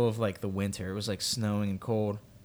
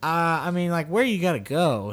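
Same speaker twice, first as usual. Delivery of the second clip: reluctant; 7 semitones higher, 4 dB louder than usual. Retold or imitated. Reluctantly offering stranger a ride.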